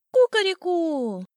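high, loud, drawn-out cock-a-doodle-doo; piercing rooster crow. A narrator's reading. Rooster showing contempt for the sparrow, piercing crow. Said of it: "Пронзительно заорал своё единственное".

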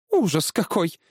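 high, startled gasp of words; frightened exclamation. A narrator's reading. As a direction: exclaim as if shocked. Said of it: "испуганно распахнув глаза". (испуганный возглас жены)

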